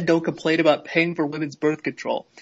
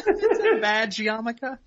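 laughing disbelief; 7 semitones higher, slower than usual. Real, not acted. Incredulous question while laughing.

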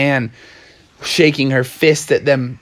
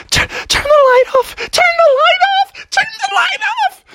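very high, shrieked order; frantic yelling. Acted. Alyssa hides from Anne, high frantic yelling. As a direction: yell in rising squeaky panic. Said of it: "Alyssa started yelling".

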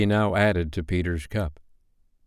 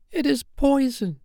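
slow, very high fairy voice; drawn-out, high-pitched warning. Acted. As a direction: warn him slowly in a tiny high voice. Fairy warns Peter of poison.

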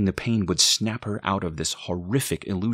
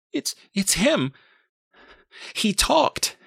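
very high, halting panic; panicked; 4 dB louder, slower than usual. A narrator's reading. Mother tells boyfriend the baby spoke, high panic. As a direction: stammer it out high, in shock.

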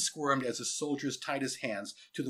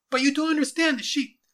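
put-on high, loud boy's protest; urgent, high-pitched objection; desperate plea; 10 dB louder than usual. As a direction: plead frantically for a friend. Boy pleads for his friend.

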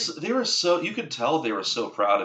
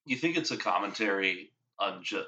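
ordinary talk

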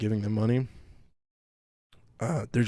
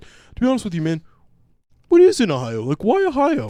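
high, loud mock incredulity; hammed-up scoffing friend; 8 semitones higher, 6 dB louder than usual. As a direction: pitch the questions way up in disbelief. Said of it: "I had a few friends from Virgi".

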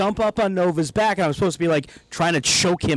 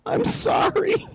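very high, slow tearful apology; hammed-up crying sorry, pitched way up; mock whimper; slower than usual. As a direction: squeak it out like a hurt child. Crying in so much pain.

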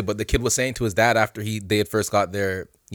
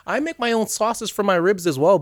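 high put-on lady voice; old woman customer; 9 semitones higher than usual. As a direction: imitate her chatter, pitched up.

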